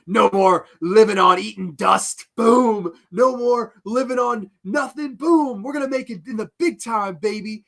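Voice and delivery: hammed-up heel promo, high and loud; Sam. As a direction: boast like a villain cutting a promo. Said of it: "he gives his one last promo".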